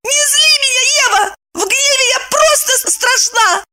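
very high, heated threat; angry warning. A narrator's reading. Evdokia warns Eva of her wrath, high and angry. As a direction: warn furiously, voice shooting up. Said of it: "окончательно разъярила подругу".

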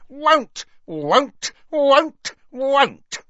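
very high, insistent elf croak; croaking house elf. A narrator's reading. House elf refusing over others' talk, shrill croaking. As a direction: croak each refusal out sharply. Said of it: "Creature's continued croaks".

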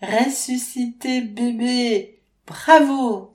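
high, loud triumphant cheer, slow; exulting. A narrator's reading. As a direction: cry out in joyful relief. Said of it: "exulte Faustine".

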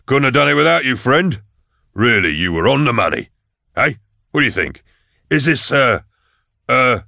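loudly chummy, pulling a friend in; boisterous Falcon. A narrator's reading. Falcon hugging his friend before the crowd, boisterous.

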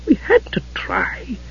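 hushed, slow, breath-only voice; near-whisper it slowly; Gillian. A narrator's reading. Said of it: "the hitherto silent Gillian who spoke".

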